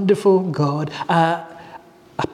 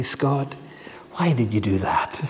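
gentle Lord; 7 semitones lower, 7 dB quieter than usual. Retold or imitated. The Lord speaks to Scott.

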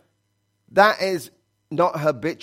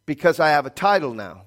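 ordinary delivery

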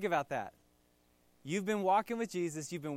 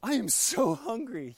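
higher, louder whining complaint; casual griping; whiny hunger; 5 semitones higher, 6 dB louder than usual. Retold or imitated. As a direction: moan about being starving. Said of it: "then they're like".

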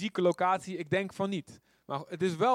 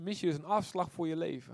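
normal talking voice

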